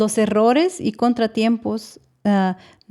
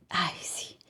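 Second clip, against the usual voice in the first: whispered, scoffing "ay, sí"; unvoiced, sarcastic brush-off; mocking ay sí; 2 semitones lower, 11 dB quieter than usual. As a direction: breathe "ay, sí" out as a dismissive sneer. Mocking the others' opinions.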